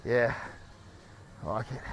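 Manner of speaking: ordinary talk, nothing marked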